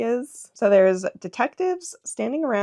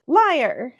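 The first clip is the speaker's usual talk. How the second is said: loud, pitched-up accusing cry; accusing shout; 9 semitones higher, 5 dB louder, slower than usual. Acted. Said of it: "Who said that?".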